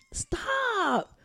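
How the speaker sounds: laughing. Real, not acted. Breaks off laughing.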